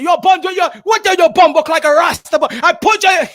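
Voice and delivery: hammed-up New York slang; New York street English. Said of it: "the New York English".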